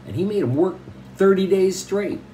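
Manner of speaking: ordinary talk, nothing marked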